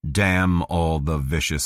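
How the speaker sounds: plain reading voice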